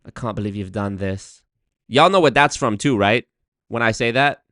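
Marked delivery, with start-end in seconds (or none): 0.0-1.4 put-on soft, low posh English accent; mock posh English accent. Showing off the English accent, posh and soft. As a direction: quote it quietly in posh English. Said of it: "do I sound posh?".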